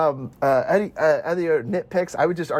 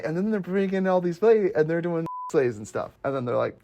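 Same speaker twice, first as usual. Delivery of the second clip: usual speaking voice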